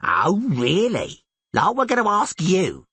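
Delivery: high, drawn-out sneering taunt; mocking. A narrator's reading.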